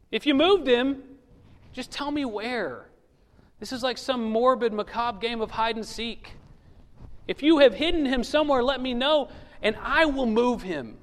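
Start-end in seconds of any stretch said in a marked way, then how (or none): 0.1-2.9 pleading Mary. Acted. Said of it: "she accuses the gardener". 7.3-11.0 pleading Mary. Acted. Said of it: "she accuses the gardener".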